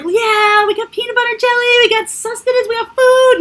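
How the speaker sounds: put-on high, fast, triumphant kid voices; excited kids. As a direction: cheer like thrilled little runaways. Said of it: "we were all on board of like".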